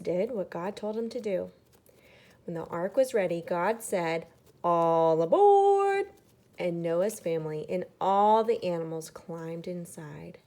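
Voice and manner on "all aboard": slow, much higher and louder call of God; booming call. Acted. God calling family and animals onto the ark, slow booming call. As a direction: boom it out like calling everyone aboard.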